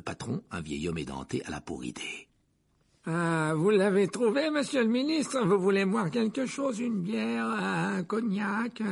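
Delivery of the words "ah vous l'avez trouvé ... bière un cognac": put-on high, slow old man's voice; an obliging old innkeeper offers drinks. Tom the old landlord offers the minister drinks, high and slow.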